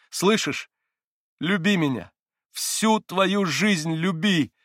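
rising from ardent confession to loud, high demand; fervent, almost threatening. A narrator's reading. Katya confesses eternal love to Mitya, almost threatening. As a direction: build to a slow, insistent cry. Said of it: "с каким-то почти угрожающим дрожанием в голосе".

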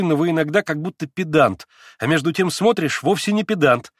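put-on eager young woman pressing her point; young Lise. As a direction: play the agitated young girl Lise. Lise teasing then urgent.

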